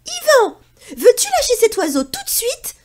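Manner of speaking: very high, loud stern command; scolding shout. Acted. Pierre scolds Yvan the cat, very high and loud. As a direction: order the cat to let go now.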